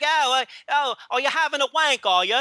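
mock Cockney hooker. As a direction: imitate an 1870s London streetwalker in Cockney. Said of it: "don't say it with that accent like, like you're a hooker in eighteen seventy".

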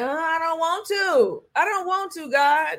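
put-on high, whiny little-girl protest to God. Telling God she won't, in a little-girl whine. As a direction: pout it out like a small daughter refusing. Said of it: "That's how I talked".

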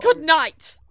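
high, shouted sign-off; angry parting shot. Acted. Crewmate storms off after quarrel, angry parting shot. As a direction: yell it on the way out.

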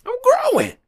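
very high, proud teasing; squeaky high proud joke; playful self-praise. Real, not acted. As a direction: brag jokingly about growing. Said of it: "Learning shit with y'all".

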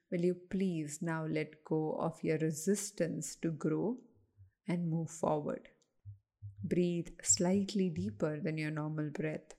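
unhurried, even-voiced relaxation coaching; guided meditation. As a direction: lead listeners gently through breathing, with pauses.

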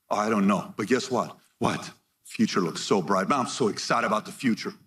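low, unbothered acted reply; understated, low-pitched optimism; relaxed optimist. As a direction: shrug it off in a low voice. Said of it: "walk around the room like this".

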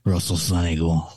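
low, slightly spooky; lightly voiced creepy figure. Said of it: "I can't do my spooky voice".

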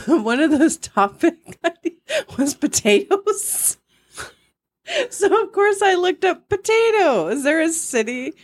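giggling through the words, pitch up high; can barely hold the laugh in while telling it; breaking into laughter mid-sentence. Real, not acted. Giggling while reporting potato topic.

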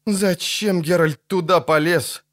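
mild dismayed wail; gasped dismay. A narrator's reading. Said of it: "охнул Лютик".

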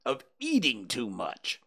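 stern, with weight on "eating"; pointed, stern reproach; stern rabbit. A narrator's reading. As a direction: scold like a disapproving rabbit. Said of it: "said the rabbit sternly".

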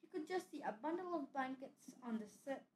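plain reading voice

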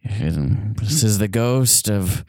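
slow, low mock ghost voice; a lightly hammed-up ghost; playful spooky voice. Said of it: "do your best ghost impression".

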